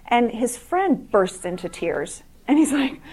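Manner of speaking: usual speaking voice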